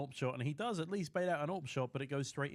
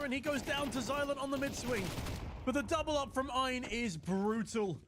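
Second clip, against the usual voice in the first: high-pitched caster call building to a peak; excited play-by-play; 12 semitones higher than usual. Real, not acted. Caster calling a fumbled nade, excited play-by-play. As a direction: ride high, then soar into an alarmed cry.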